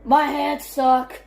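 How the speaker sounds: ordinary talk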